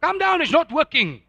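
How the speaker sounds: preacher echoing the family's scoff, loud and mocking; mocking loud repeat. Shown as a demonstration. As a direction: throw their scoff back at the crowd.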